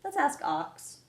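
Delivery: a small eager rat; Rat's voice. Acted. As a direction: Rat suggesting to ask Ox. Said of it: "said Rat".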